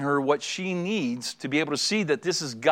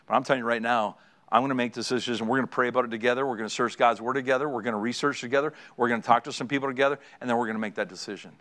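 lower, contrite husband apologizing; humble apology; 6 semitones lower, faster than usual. Shown as a demonstration. Husband to wife after bad decisions, lower contrite apology. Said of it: "you have to say".

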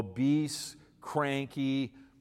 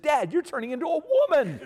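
high, loud, fast mock outrage; incredulous shout with a laugh behind it; shocked exclamation; 8 dB louder, faster than usual. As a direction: blurt it out in comic horror. Son's shocked punchline to his dad.

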